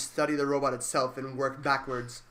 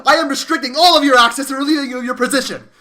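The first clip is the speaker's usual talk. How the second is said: very loud, higher furious bellow; outraged shout; 9 semitones higher, 12 dB louder than usual. Acted. Director roaring at insubordinate employee, furious shout. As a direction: blast him with outrage.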